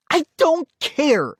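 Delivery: high, drawn-out angry cry. A narrator's reading. Sister brushing off brother's insult, crying out. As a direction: cry it out slowly and crossly. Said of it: "Molly cried".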